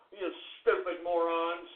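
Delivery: ordinary talk, nothing marked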